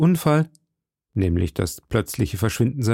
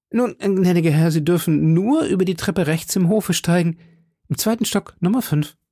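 high-pitched landlady giving directions; high voice of an obliging innkeeper; innkeeper woman; 6 semitones higher than usual. Acted. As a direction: direct the guest as the hostess, pitched well up.

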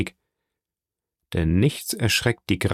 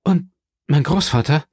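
trembling; 6 semitones higher, 3 dB louder than usual. Acted. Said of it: "fragte das Mädchen zitternd".

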